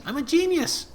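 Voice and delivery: high, loud triumphant outburst; excited. Acted. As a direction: crow it like a brilliant discovery. Imp struck by a lazy idea.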